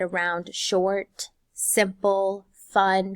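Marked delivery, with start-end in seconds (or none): none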